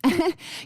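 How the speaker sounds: short laugh. Real, not acted. Host to guest before asking for listeners, short laugh. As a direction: let out a quick little laugh.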